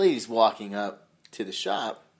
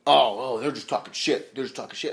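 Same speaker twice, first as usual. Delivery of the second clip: put-on a dismissive young listener, first words louder; mock millennial; 5 dB louder than usual. Millennial listener dismissing the hosts, mock scoff. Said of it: "to go".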